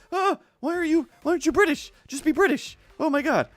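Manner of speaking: very high-pitched exasperated rant; mock exasperation. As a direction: plead in squeaky mock despair. Acted plea to the actor.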